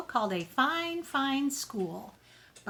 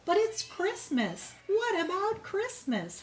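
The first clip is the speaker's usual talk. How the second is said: can't believe it's Christmas school; dismayed little brother; 9 semitones higher than usual. Acted. Brother crying out to Tilly on Christmas, much higher and dismayed. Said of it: "her brother called".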